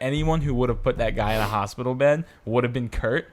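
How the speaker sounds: usual speaking voice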